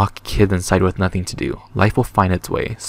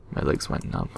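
whispering; 6 semitones lower, 9 dB quieter than usual. Real, not acted. Scared aside while hiding.

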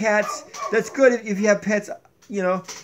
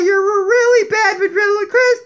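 high, lively puppet voice; Donkey puppet voice; 13 semitones higher, 7 dB louder than usual. Acted. Puppet Donkey lecturing viewers about cats, high puppet voice. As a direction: play the sassy donkey puppet, pitched up. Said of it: "I'm a really bad ventriloquist".

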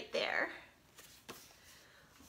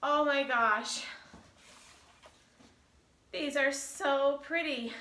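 ordinary talk, nothing marked

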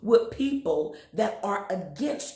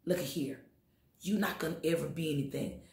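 softer, sly taunting enemy; voicing the enemy; 4 semitones lower, 6 dB quieter than usual. Preacher voicing the enemy's taunt, soft and sly. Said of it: "When the enemy says".

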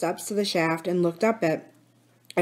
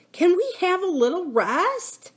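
put-on high, small, tired child's voice; panting plea; 11 semitones higher than usual. A narrator's reading. Lucy Ann begging Philip for a rest, panting. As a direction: plead wearily in a high voice. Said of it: "panted Lucy Ann".

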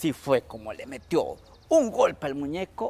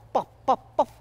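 boiling pops; 10 semitones higher, 10 dB louder than usual. A narrator's reading. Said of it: "hacía borbollones".

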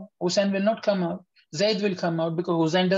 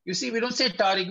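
normal talking voice

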